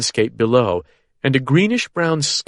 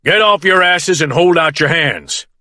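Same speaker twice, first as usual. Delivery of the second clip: loud, higher barked command; shouted order; 6 semitones higher, 4 dB louder than usual. A narrator's reading. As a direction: shout it at the seated men. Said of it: "Dennis shouts".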